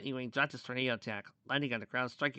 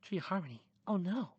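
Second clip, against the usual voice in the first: mild worry in a character's voice; worried; 7 semitones higher than usual. A narrator's reading. Starlight reacting to the Tree of Harmony threat, worried. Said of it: "Starlight said in a worried tone".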